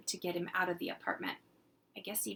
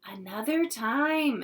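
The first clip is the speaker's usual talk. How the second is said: slower, louder firm mom; firm mother; 5 semitones higher, 6 dB louder, slower than usual. Acted. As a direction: end it kindly but firmly. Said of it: "his mother said".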